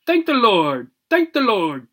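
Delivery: high, joyful exclamation; happy cry. Acted. Relieved dog happily cries thanks to the ceiling. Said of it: "happily cried out".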